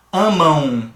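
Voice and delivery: "Amam" with a buzzing nasal close; "Amam" com vibração nasal. Shown as a demonstration. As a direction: hum the end of "Amam" through the nose. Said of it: "dá uma vibraçãozinha nasal ali".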